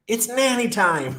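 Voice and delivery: put-on high, slowed kid announcing nanny time; little kid voice. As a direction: announce it like an excited five-year-old. Five-year-old son voiced in retelling.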